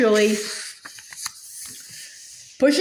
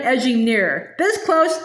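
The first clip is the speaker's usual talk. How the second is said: usual speaking voice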